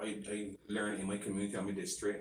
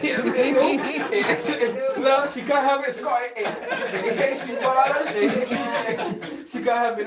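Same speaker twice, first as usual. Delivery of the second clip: excited laughing; 12 semitones higher, 11 dB louder, slower than usual. Real, not acted. Hyped laughing praise of guest.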